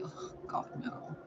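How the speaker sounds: hushed, unvoiced mock dread; barely audible whispered reluctance; whispered dismay. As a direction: whisper it as if pleading under your breath. Acted dread at being told.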